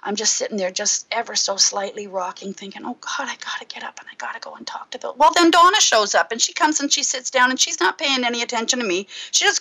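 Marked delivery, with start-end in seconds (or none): 2.8-5.2 rushed, breathless whisper to himself; hurried whisper, scared to be overheard; anxious whisper. Retold or imitated. Whispering to himself in the pizza place, nervous and hurried. Panicked inner thought at the restaurant.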